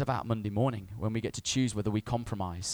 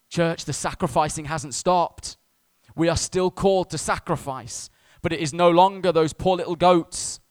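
raised, higher-pitched preacher's proclamation; preaching; 6 semitones higher, 7 dB louder than usual. Real, not acted. Preacher urging the church to keep sacrificing, impassioned preaching. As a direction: preach it out loud and high.

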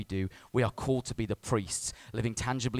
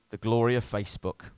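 normal talking voice

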